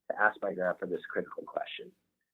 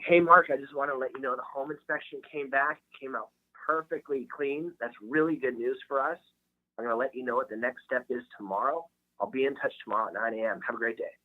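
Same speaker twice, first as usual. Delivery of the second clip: higher-pitched, upbeat client update; sample video message; 5 semitones higher than usual. Shown as a demonstration. Realtor updating client on the home inspection, upbeat video-message voice. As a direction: deliver it like a quick personal check-in video. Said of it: "It's usually something like".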